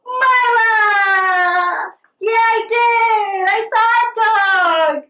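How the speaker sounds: high, loud and drawn out in a sing-song call; excited shout. Real, not acted. Teacher calling out to her dog Mila, loud and thrilled.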